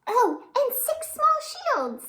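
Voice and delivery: a gasp, then high and marvelling; gasping wonder. A narrator's reading. Storyteller gasps at the six small shields, marvelling. Reading wonder-filled picture book.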